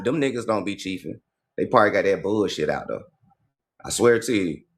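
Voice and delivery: usual speaking voice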